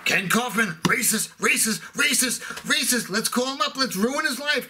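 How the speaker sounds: jeering, high-pitched imitation of a scold; mock outraged SJW. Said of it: "some S J W on me saying".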